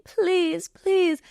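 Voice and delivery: high mock pleading, begging the border; hammed-up begging, pitched high; imitating a politician pleading, high voice. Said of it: "like, pleading".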